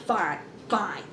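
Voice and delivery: low, soft, resigned "fine"; grudging acceptance. Retold or imitated. Daughter giving in to mama, sulky. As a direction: give in quietly and low.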